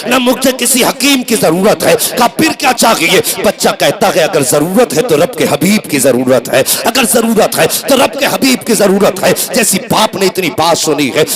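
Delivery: driving, emphatic sermon storytelling; fervent Urdu preacher's delivery; impassioned preaching. A narrator's reading. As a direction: preach the tale in urgent, unbroken waves. Preaches a story at full force.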